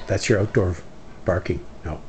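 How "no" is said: ordinary talk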